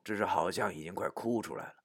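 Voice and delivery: ordinary delivery, nothing marked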